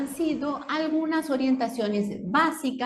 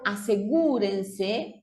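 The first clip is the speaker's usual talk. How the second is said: asegúrense said slowly, leaning on the gú; slow, stressed U; slower than usual. Shown as a demonstration. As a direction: draw out asegúrense and hit the gú. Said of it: "lleva acento en la U". (asegúrense despacio, con acento en la gú)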